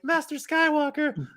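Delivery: put-on frightened youngling in a Scottish accent; mock scared Scottish child. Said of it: "In his little Scottish accent".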